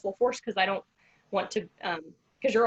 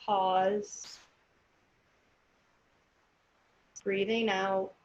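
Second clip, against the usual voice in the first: slow, calm counting of breaths; guided breathing; slower than usual. Acted. Leads counted breathing exercise.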